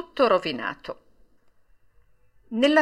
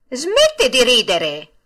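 slow, louder annoyed order; irritated; 4 semitones higher, 6 dB louder, slower than usual. A narrator's reading. Geppetto to the laughing puppet, irritated order. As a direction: scold the mocking mouth in irritation. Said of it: "disse Geppetto impermalito".

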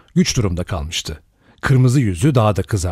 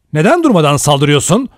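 louder angry challenge; 5 semitones higher, 5 dB louder, faster than usual. A narrator's reading. Lombard angrily confronts Blor, louder. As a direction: confront him hotly. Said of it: "kızgın bir sesle".

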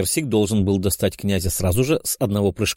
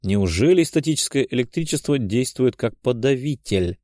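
ordinary delivery

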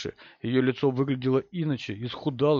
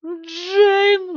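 piercing high, loud shriek of the name; 8 dB louder than usual. A narrator's reading. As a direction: shriek for a lost daughter. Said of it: "взвизгнула Констанс". (пронзительный визг)

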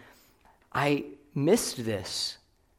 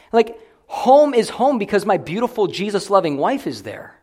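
ordinary talk

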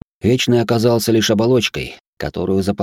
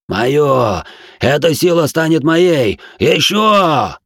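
high, loud, greedy screaming; frenzied yelling; 7 semitones higher, 4 dB louder, much slower than usual. Acted. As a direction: howl it like a crazed fanatic. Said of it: "эти вопли".

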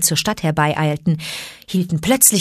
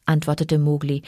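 ordinary delivery, nothing marked

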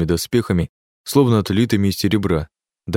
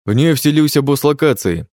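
shouting; 6 semitones higher, 3 dB louder than usual. A narrator's reading. Impostor keeps shouting lies.

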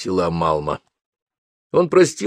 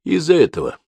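brief falling admission; father sadly confirming his daughter's love; resigned confirmation; 7 semitones higher than usual. Acted. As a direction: concede it with head bowed. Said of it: "склонив голову, подтвердил отец".

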